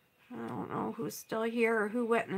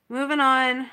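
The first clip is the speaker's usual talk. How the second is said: louder, firm moving on; emphatic self-push; 2 semitones higher, 11 dB louder than usual. Real, not acted. To viewers after the ruined painting, louder firm resolve. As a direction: push herself forward with a loud resolve. Tells herself to move on.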